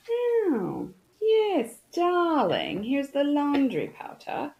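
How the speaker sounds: high, plummy British voice; sudden posh British accent for fun. Pretend. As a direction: play a grand English lady. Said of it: "Why did I go into that accent?".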